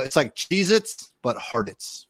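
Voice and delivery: "Hartitz" rhymed with "Cheez-Its", HAR-tits; name pronunciation. Shown as a demonstration. Guest teaching listeners to say his name as "Hartitz", like "Cheez-Its". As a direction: say "Hartitz" just like "Cheez-Its", stress on the first syllable. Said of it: "For everyone out there".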